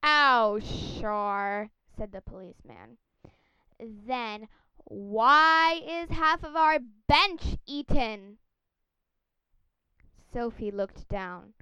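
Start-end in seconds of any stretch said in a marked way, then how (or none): 0.0-1.7 loud sarcastic cop scoff; london policeman. Acted. 3.8-8.4 loud, slow accusing cop voice; london policeman. Acted.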